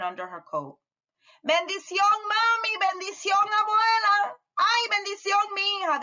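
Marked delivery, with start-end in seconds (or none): 1.4-6.0 high called blessings across the house; calling out. Acted. Family calling blessings to Lola, high and ringing. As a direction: sing out the goodbyes. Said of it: "they both called".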